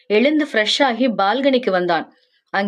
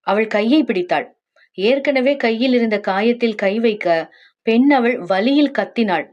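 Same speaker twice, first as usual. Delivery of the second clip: ordinary delivery, nothing marked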